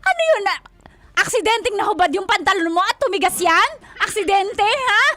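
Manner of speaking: loud, incredulous tirade; shouted, outraged grilling; furious questioning. Real, not acted. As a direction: throw the excuse back angrily. Betrayed partner mocks the excuse.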